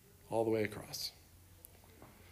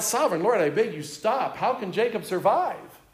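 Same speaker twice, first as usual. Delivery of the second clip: higher, louder anguished plea; crying out; 6 semitones higher, 5 dB louder than usual. A narrator's reading. Amos begs God to stop the fire, crying out. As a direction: cry out to God in desperation. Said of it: "I cried out".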